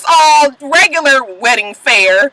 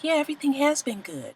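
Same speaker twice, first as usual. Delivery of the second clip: much softer, meek echo of her; quiet mimic; 5 semitones higher, 14 dB quieter than usual. Mimicking Amina agreeing with Peter, meek and soft. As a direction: mumble it back meekly. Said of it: "she was just like".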